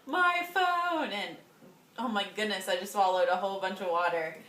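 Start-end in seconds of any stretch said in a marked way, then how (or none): 0.1-1.1 high-pitched mock wail; reenacted cry of loss, pitched way up; retold dismay. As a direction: cry it out high without much volume. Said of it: "So then I was like".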